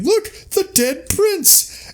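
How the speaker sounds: very high, slow exclaiming voice; excited cry. Retold or imitated. A guest spotting the prince, very high excited cry. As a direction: shriek it out like a startled guest. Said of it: "somebody's like".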